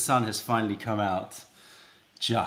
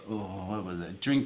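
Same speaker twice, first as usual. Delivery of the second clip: ordinary delivery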